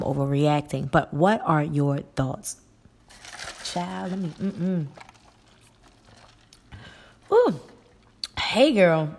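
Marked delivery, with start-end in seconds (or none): 3.6-9.2 coughing after a sip went down wrong; strained voice right after choking; choking on a drink. Real, not acted. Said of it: "That went down the wrong pipe".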